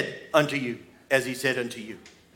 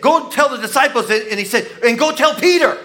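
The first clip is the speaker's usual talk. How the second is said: higher and louder towards Peter; rising excitement; 8 semitones higher, 5 dB louder than usual. Acted. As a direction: build up to the name Peter. Peter hears his name called.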